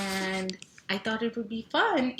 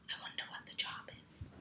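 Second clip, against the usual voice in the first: fast soft unvoiced wonder; whisper; 11 dB quieter, faster than usual. Real, not acted. Parent building suspense for kids, whispering.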